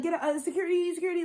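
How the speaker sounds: ずっと高い声で警備員を呼ぶ真似; 大げさに甲高くセキュリティと叫ぶふり; panicky cashier. Retold or imitated. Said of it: "she wanna be like".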